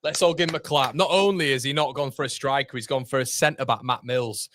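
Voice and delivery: normal talking voice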